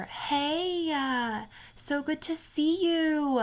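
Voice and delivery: high, slow Utah greeting with "uh"; sister's Utah uh. Retold or imitated. Imitating her sister greeting someone in a high slow Utah accent. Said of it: "She goes".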